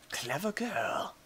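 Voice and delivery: slow breathy hush of a movie line; hushed dramatic whisper. Pretend. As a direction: lean in and breathe it like a hunter. Quoting the raptor line softly.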